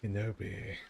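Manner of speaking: a hint of a snarling Sith lord; Darth Maul voice. Shown as a demonstration. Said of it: "my Darth Maul".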